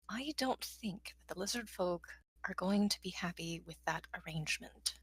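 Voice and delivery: low, soft warning aside; quiet. Acted. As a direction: murmur it close to her ear. Takes her elbow, quiet aside.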